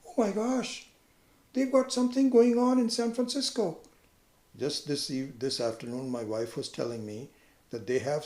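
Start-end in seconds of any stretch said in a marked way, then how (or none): 0.1-3.8 high mock excitement over news; excited TV chatter. Teacher imitating distracted talk about news. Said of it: "another T V program go".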